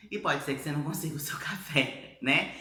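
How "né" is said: ordinary talk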